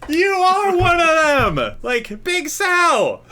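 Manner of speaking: high, slow, loud excited squeal; excited high shriek. Acted. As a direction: squeal it out, thrilled to recognise him. Drunk woman excited recognition.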